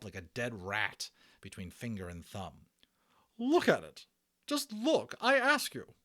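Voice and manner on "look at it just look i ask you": very high, loud, scandalised nurse; outraged matron. Acted. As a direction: squeal it out in disgust. Matron disgusted at old syringe.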